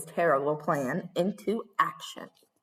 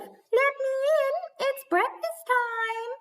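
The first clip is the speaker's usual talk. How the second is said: much higher, slow squeaky put-on voice; squeaky wolf. Wolf pretending to be Little Red at the door, squeaky. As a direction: squeak like a wolf faking innocence. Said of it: "squeaked the wolf".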